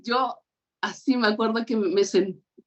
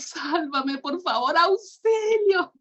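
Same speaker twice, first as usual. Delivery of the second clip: tearful plea; 7 semitones higher, slower than usual. Retold or imitated. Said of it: "y me pongo a llorar".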